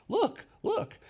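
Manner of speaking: high, urgent "look, look"; taxi driver's call. Retold or imitated. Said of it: "which means".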